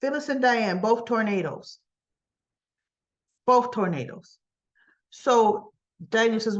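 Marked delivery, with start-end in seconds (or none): none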